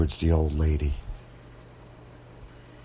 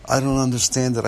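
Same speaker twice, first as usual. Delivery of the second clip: upset, tearful; 6 semitones higher, faster than usual. A narrator's reading. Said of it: "tears streaming down her face".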